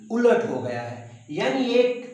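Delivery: usual speaking voice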